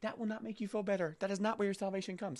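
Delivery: high, tender coaxing, lightly voiced; gentle Spirit voice. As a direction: nudge him kindly, like a patient guide. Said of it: "Spirit is whispering to them".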